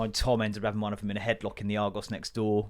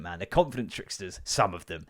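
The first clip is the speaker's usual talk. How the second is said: usual speaking voice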